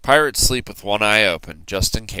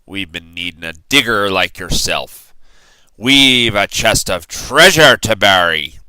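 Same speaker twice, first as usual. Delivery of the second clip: pirate voice; 7 dB louder than usual. A narrator's reading. Captain recruiting the boy to bury treasure, pirate voice.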